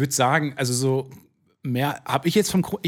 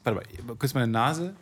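usual speaking voice

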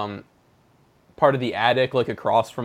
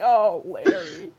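mock sympathy; 8 semitones higher, 5 dB louder than usual. Consoling doomed character.

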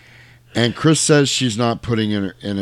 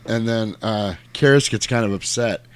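ordinary delivery

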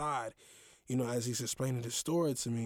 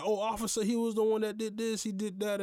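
higher, lightly voiced coworker pointing the finger; a hint of an eager snitch to the officer; mock tattling coworker; 9 semitones higher, 4 dB louder than usual. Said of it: "saying that".

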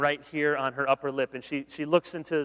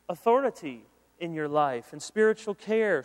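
normal talking voice

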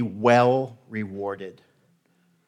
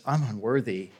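calm, plain, level statement; understated matter-of-fact admission; 3 semitones lower, 6 dB quieter than usual. Retold or imitated. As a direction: say it as a simple fact, no fuss. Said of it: "Very matter of fact".

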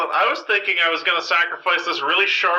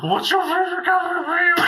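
squeaky Kaufman brother; 10 semitones higher than usual. Acted.

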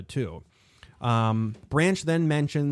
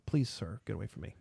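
hushed aside; 3 semitones lower, 10 dB quieter than usual. Real, not acted. Parent to son barging in on the podcast, hushed aside. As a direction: quietly shoo someone away.

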